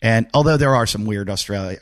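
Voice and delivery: ordinary delivery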